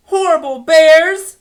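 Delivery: loud, high furious yell; angry shout. Acted. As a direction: shout it out at the bear. Girl shouts while reading.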